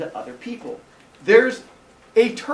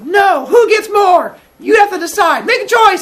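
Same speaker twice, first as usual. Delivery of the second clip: shouting demand; 11 dB louder than usual. Retold or imitated. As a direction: yell it up high like a demanding crowd. Villagers shouting demands at Aesop.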